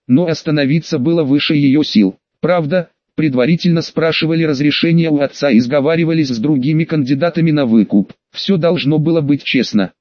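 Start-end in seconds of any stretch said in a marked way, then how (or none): none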